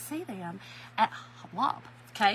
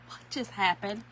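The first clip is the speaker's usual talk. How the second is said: normal talking voice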